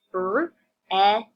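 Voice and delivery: "Br-ed" in separate sounds, loud; "Br-ed" broken into its sounds with gaps between; sounded out. Shown as a demonstration. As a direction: sound out "Br-ed" piece by piece for young readers. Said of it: "we're gonna sound it out together".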